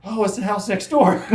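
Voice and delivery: high, loud mock dismay; retold sudden realization. Agent realizing the wrong house before clients, comic dismay. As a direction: act out spotting your own blunder.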